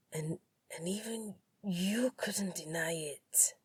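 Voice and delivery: halting, resigned hurt; pained. Acted. The pain in his voice.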